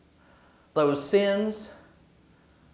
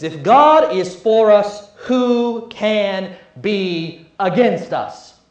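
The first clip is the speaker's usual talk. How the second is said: rising sermon proclamation; 2 semitones higher, 6 dB louder than usual. Acted. Preacher rallies the congregation with Paul's words, loud and building. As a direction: proclaim it to the back pews.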